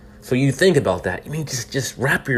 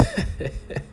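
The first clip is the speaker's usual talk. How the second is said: short laugh; 10 dB quieter than usual. Real, not acted. Laughs at absurd news.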